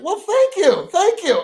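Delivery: high, delighted thank you; flattered thanks. Real, not acted. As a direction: gush like you're flattered. Flattered at being called intellectual.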